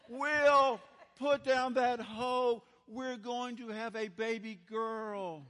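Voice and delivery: loud and high, slow and insistent, fading at the end; insistent call. Retold or imitated. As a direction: make him stop hoeing, slowly and firmly. Wife calls again, he ignores her.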